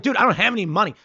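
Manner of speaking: exasperated protest. Retold or imitated. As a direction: plead exasperation to a pushy mugger. Insisting to a street shakedown.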